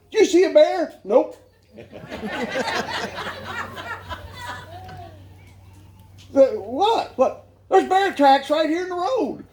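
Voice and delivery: hammed-up suspicious outburst; mock angry guide. Angry hunting guide grilling the client who missed.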